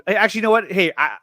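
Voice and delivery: ordinary talk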